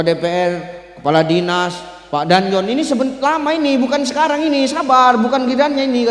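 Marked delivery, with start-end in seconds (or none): 2.7-6.1 high, insistent mock protest; retold objection in a raised pitch; protesting gatekeeper. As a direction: push back, telling them to wait.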